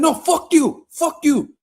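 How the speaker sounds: high, loud African accent yelling in mock argument. Imitating an African boy cursing at a gas station, high loud accent. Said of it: "this fucking African boy arguing".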